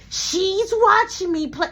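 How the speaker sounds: put-on high insistent kid voice; kid brother voice. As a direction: play the stubborn little boy.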